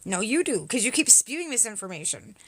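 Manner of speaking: ordinary talk, nothing marked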